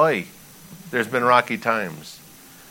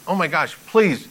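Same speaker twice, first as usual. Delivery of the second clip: higher-pitched, urgent plea; pleading; 6 semitones higher than usual. Acted. Pastor voicing God's plea to his people, pleading. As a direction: beg with a rising-intensity "please". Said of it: "he's pleading with".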